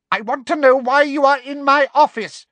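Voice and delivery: very high, loud interrogation; furious demand. Acted. Umbridge interrogates the intruder in her office, furiously. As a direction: demand an answer at full pitch.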